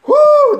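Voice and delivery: high loud whoo of pain. Retold or imitated. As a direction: cry out from the burn. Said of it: "Just losing my shit".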